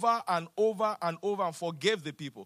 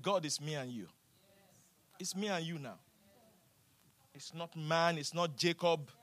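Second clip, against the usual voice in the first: quiet prayer; 3 semitones lower, 6 dB quieter than usual. Acted. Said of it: "She said".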